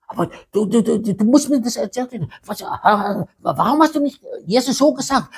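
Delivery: fast, high, accusing rush; disciples arguing. Acted. Grieving disciples blaming one another, fast and high. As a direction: fire the blame at each other. Disciples blame each other.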